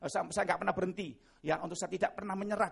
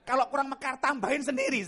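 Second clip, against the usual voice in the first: mock exhortation; 9 semitones higher, 7 dB louder than usual. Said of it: "saya bilang gitu".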